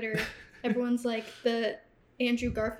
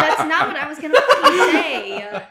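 louder, laughing indignation; laughing protest; 5 semitones higher, 13 dB louder than usual. Real, not acted. Laughing protest after being teased.

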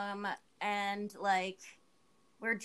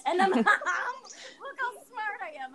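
laughing at herself; 9 semitones higher, 5 dB louder, slower than usual. Real, not acted. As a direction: chuckle through it. Self-mocking laugh.

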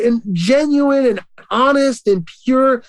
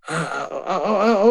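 voice breaking; 2 semitones lower, 5 dB quieter than usual. Real, not acted. Recalling abuse, voice breaks.

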